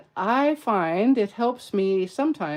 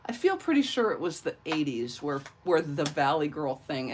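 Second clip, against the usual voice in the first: usual speaking voice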